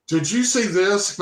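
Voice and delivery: high, drawn-out urgent question; slow, high-pitched mock panic; acted alarm. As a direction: imitate a sister rushing in freaked out. Sister runs in, acted freak-out.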